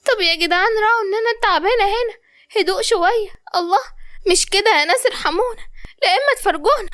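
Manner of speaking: plaintive complaint. Acted. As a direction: beg them all to hush, pitched well up. Tired patient begs for quiet.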